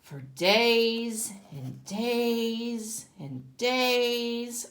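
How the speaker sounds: very slow, stretched days; drawn out. A narrator's reading. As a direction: let the days drag on slowly. Narrator stretches passing days.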